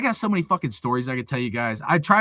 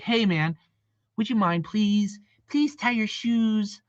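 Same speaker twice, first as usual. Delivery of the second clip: soft, slow, higher-pitched fake politeness; mock polite pleading; 5 semitones higher, 4 dB quieter, slower than usual. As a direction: plead sarcastically, as if coddling a delicate kid. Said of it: "You guys want me to be like".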